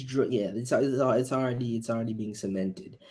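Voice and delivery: usual speaking voice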